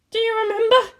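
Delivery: high haunting question; eerie voice. Acted. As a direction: ask it like a ghost.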